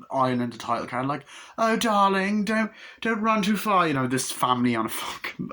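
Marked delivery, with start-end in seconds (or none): 1.6-3.9 high-pitched fussing movie mother; mock doting mother. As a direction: put on a high, sing-out parent voice. Voices mother in film scene.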